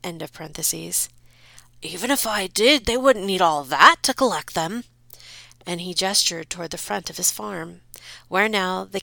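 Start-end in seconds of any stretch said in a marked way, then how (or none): 1.8-4.8 louder, indignant reply; defensive father. Acted. Farmer defends himself to wife, indignant. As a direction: snap back at the wife.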